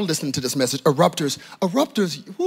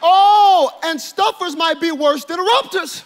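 high, ringing sermon delivery; impassioned preacher in full flight; full-force preaching; 10 semitones higher, 4 dB louder than usual. Real, not acted. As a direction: hammer each warning at the congregation.

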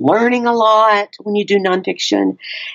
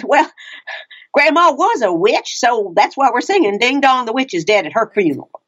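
put-on Mama Cherie's Texas accent; 4 semitones higher than usual. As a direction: deliver it deadpan in a raised Texas mama voice. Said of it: "the accent that you gave her".

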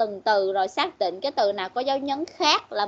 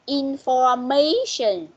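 Information slowly, with "ma" stretched and lifted; stressed syllable demo; slower than usual. Shown as a demonstration. Teacher asking where the stress falls, slow Information stressing "ma". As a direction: model Information so the stress on "ma" is obvious. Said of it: "Rồi, nhấn ở đâu?".

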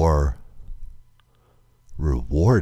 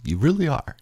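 real tears pushing the voice much higher; voice breaking high; 13 semitones higher than usual. Real, not acted. Vlogger telling listeners they matter, voice breaking high. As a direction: let it crack upward as you say it. Tearful thanks, repeated.

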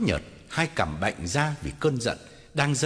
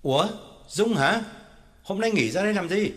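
calling back; 7 semitones higher than usual. Acted. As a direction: answer back from another room. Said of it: "đáp vọng ra". (đáp vọng ra từ phòng trong)

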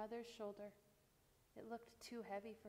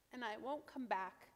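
defiant but shaky, louder at the end; 6 dB louder than usual. A narrator's reading. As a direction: voice trembling, near tears. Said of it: "in a shaky voice".

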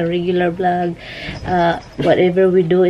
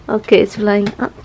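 ordinary talk, nothing marked

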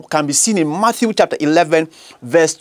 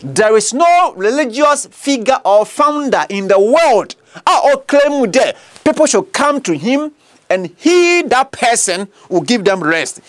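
slow and higher, hammering the claim; forceful preaching; 4 semitones higher than usual. Acted. Preacher insisting no founder promises rest. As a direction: drive it home slowly.

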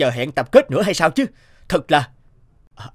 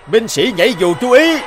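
shouted command; 8 semitones higher, 4 dB louder than usual. Acted. Officer calls paratroopers to attention.

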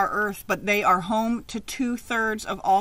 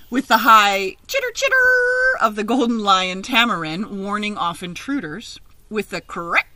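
lively picture-book reading; read-aloud story; 2 semitones higher, 5 dB louder than usual. Acted. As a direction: read it out to a class of children.

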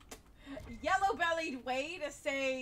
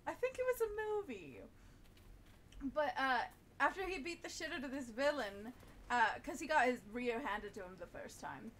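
usual speaking voice

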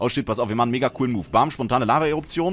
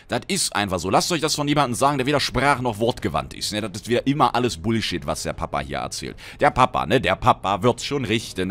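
ordinary talk

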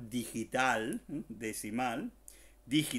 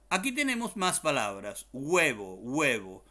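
usual speaking voice

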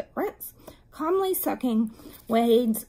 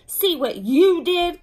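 loud, high "you", throwing the blame back; slow, indignant retort stressing "you"; contradicting; 6 semitones higher, 8 dB louder, slower than usual. A narrator's reading. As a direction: hit "you" hard and slowly. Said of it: "contradicted Beezus".

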